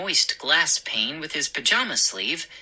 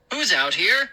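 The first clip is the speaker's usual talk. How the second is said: mildly raised calling voice; 4 semitones higher, 3 dB louder than usual. Acted. Father calling into the foggy yard, calling out. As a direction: call into the dark yard. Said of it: "my father called".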